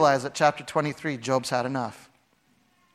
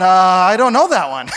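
sheepish Job; 7 dB louder than usual. Retold or imitated. Job answering God sheepishly.

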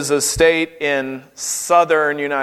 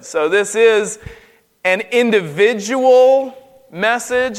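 ordinary talk, nothing marked

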